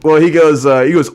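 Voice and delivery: ordinary talk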